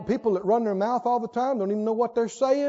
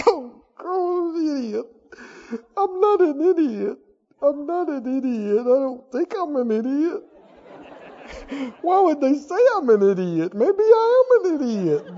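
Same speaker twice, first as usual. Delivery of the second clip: mock self-pity; 4 semitones higher than usual. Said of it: "mocking self-pitying listener".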